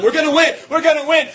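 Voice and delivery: high, loud rallying cry; defiant shout. Retold or imitated. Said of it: "tried to tell them".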